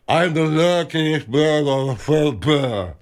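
slow, halting speech voice; Lou Gehrig impression. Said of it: "I was doing a Lou Gehrig impression".

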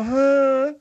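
mock pouty boy's "huh"; pouty thirteen-year-old. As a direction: lightly imitate a sulky teenager. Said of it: "and get all pouty".

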